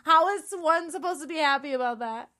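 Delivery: high, loud, exasperated protest; indignant complaint. Acted. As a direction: complain in hammed-up outrage at being ignored. Mock quarrel over not being heard.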